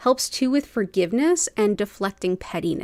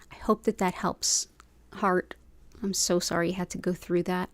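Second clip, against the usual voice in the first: usual speaking voice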